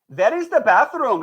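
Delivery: English in a Pakistani accent. Shown as a demonstration. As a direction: say "bathroom" with a Pakistani accent, "pathroom". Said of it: "speak English with a Pakistani accent".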